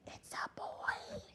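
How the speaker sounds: put-on breathless stage whisper to the kids; dramatic whisper. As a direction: hush it like a big secret. Dramatic aside to listeners.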